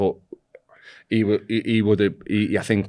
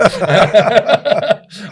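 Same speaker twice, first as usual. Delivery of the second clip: high burst of laughter; loud laugh; 11 dB louder than usual. Real, not acted.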